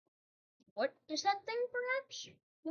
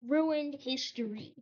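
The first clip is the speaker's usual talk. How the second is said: ordinary talk, nothing marked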